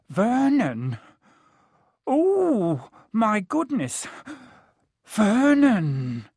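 high, horrified wail of a woman; choking shock. A narrator's reading. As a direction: cry out as if about to faint. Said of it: "made a choking noise".

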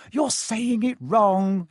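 sharp, slow know-it-all snap; snapping. Acted. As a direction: correct him with a bossy snap. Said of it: "Harry heard Hermione snap".

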